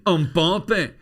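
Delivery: put-on high loud Scottish-accented shout of Mbappe. Mimicking the pundit's catchphrase for Hannah in a Scottish accent, shouted high. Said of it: "he says it with a Scottish accent".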